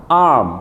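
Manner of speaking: high-pitched arm with long A. Shown as a demonstration. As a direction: model arm, holding the A. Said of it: "bacaannya agak panjang sedikit".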